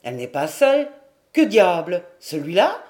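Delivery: loud, gruff puzzled man; wary villager. A narrator's reading. Villager noticing an unknown man beside her, loud and puzzled. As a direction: challenge the stranger, ending on a rising question. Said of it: "Maradour ajouta".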